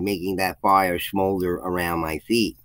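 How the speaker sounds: ordinary delivery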